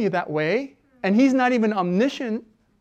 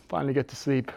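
ordinary talk

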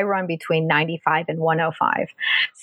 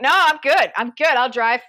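insistent refusal; 7 semitones higher, 4 dB louder than usual. Retold or imitated. Refusing the gurney, retold.

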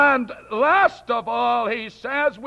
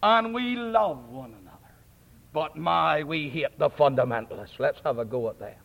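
lower, smug mock voice of church liberals; a hammed-up sanctimonious clergyman, sinking lower; mocking ecumenists; 8 semitones lower, 4 dB quieter than usual. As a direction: sneer at them by mimicking their cosy talk. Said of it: "they all sit round together and they say".

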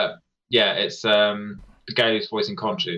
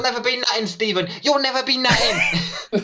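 mock bullying boss; 10 semitones higher than usual. Mocking the boss character.

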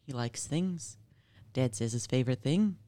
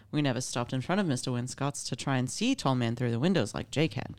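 normal talking voice

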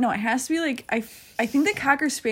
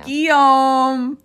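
loud, higher, drawn-out holler; dog-park yell; 5 semitones higher, 10 dB louder than usual. Shown as a demonstration. Yelling the dog's name on friend's request, loud call. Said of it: "Please yell that out at a dog park right now".

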